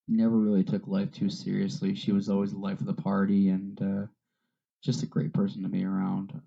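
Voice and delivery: usual speaking voice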